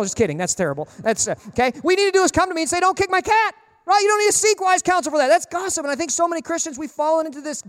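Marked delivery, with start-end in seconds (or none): 1.8-5.1 high, emphatic telling-off; mock scolding. Preacher showing how to confront the cat kicker, mock scolding. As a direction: tell them off directly, playfully indignant.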